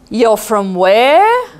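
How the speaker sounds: very slow, loud, drawn-out question; shocked disbelief. Retold or imitated. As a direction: stretch it out in disbelief. Shocked about America.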